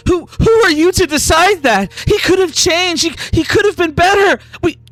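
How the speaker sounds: high, distraught, pleading argument; tearful protest. Acted. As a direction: protest through tears at the killer. Grieving wife argues with killer.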